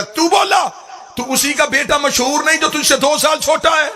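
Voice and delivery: high, shouted rebuke of the heckler; angry rebuke. Acted. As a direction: confront him with fury. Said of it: "میرے مولا حسن اس کی طرف چلے گئے". (غصے میں للکار)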